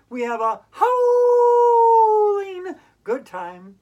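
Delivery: line howled like a happy hound. Acted. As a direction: howl it like a dog. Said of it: "silly sounds".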